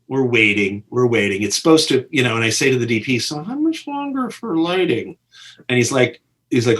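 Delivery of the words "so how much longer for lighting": tentative, higher mock-timid ask; meek question. Director nervously asks the DP about the lighting, timid. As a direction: ask it like you fear the answer.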